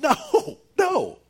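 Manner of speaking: high, loud, forceful cries of refusal; emphatic shouted no. Acted. Preacher acting Paul's answer to the accusation, shouted emphatically. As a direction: shout it down flat out, far above normal pitch. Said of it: "he's not soft with this accusation".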